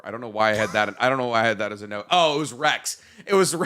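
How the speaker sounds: ordinary delivery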